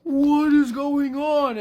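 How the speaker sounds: high, loud, drawn-out hammed-up freak-out; comic high-pitched screech of alarm; mock panicked shout. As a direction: wail it in over-the-top disbelief. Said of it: "Eddie's like".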